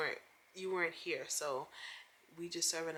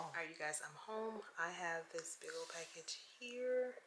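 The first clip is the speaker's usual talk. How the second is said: whisper; 6 dB quieter than usual. Real, not acted. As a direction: whisper it like a secret at the door. Sneaking home with a package.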